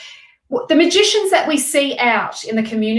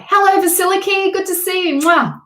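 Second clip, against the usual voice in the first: excited greeting; 8 semitones higher, 4 dB louder than usual. Real, not acted. Greeting viewer on stream.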